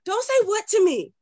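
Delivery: put-on a little boy scolding his mother; indignant toddler. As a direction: son scolding mom in a high child voice. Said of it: "he'll say".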